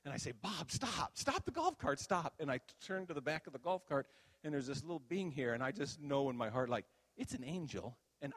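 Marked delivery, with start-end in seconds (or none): 0.3-2.3 retold excited call to stop, voice jumping high; mock-urgent, high-pitched plea; silly excitement. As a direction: playfully urge the driver to stop. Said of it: "I get excited, and I'm being silly".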